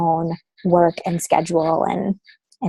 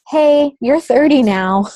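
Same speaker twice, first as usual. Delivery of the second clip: slow, higher, teasing voice of her own body; body talking; 5 semitones higher, 3 dB louder, slower than usual. Retold or imitated. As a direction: let the body scold her playfully. Body personified, speaking to her.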